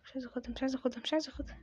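very soft, nervous repetition; hushed, anxious refusal; scared muttering. Real, not acted. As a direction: mutter it fearfully under your breath. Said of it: "انا خايف وربنا خايف".

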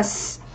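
S said as a bare hissing s sound; S as a voiceless s; demonstrated sound. Shown as a demonstration. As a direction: say S as the sound, without the letter name. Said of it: "Cách phát âm thứ nhất".

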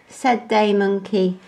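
ordinary delivery, nothing marked

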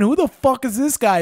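mocking a drunk stranger, loud and disbelieving; incredulous yell. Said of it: "I went".

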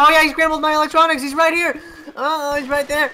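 high, loud panicked yelling; frantic shouting as the enemy hits; panicking gamer. Real, not acted. Enemy suddenly on him, shouting.

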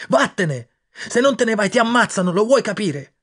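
high, loud desperate shouts; boy screaming at his friend to save himself; screaming and begging. Acted. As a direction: scream at him to go. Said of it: "Ho urlato e implorato".

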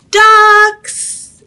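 high, loud searching call. Acted. Duck shouting for his lost duck friends, calling out.

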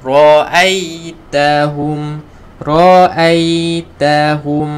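Ra'aitahum drawn out slowly with heavy ra; teacher modelling Ra'aitahum; slow recitation. Shown as a demonstration.

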